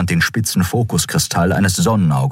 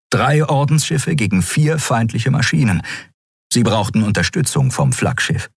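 usual speaking voice

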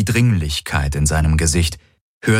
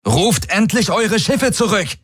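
calling out; 14 semitones higher than usual. Acted. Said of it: "rief seine Projektion".

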